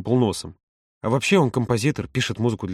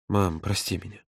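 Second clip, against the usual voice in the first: much softer, breathy apology; whispered plea; 4 semitones lower, 7 dB quieter than usual. A narrator's reading. Son begging mother's forgiveness in a whisper. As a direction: whisper it into her shoulder. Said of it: "прошептал он".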